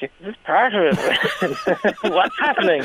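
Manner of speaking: slow, laughing confusion; baffled laughing. Retold or imitated. Audience member at the brawl, slow and laughing. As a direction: puzzle it out while cracking up. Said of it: "You're kinda like".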